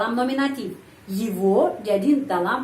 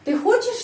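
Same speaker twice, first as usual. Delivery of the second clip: хочешь jumps high on its first syllable; sharp questioning peak on хочешь; question intonation; 12 semitones higher than usual. Shown as a demonstration. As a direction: ask it by a high lift on хочешь. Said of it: "Coba, coba ikut saya".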